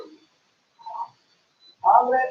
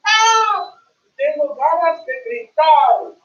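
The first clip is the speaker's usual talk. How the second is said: high, loud cry on "Ah", then slow; mock scream; 7 semitones higher, 6 dB louder, slower than usual. Teacher shows learners feeling like screaming, mock scream. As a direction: act out wanting to scream. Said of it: "you feel like screaming".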